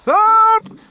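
very high, loud hysterical shrieks; panicked mother shrieking at full force; screaming. A narrator's reading. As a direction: scream for help word by word. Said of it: "screamed Missus Gloop".

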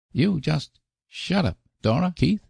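put-on high, cross little boy; small boy voice. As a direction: snap it like a sulky kid. Davy's child voice in narration.

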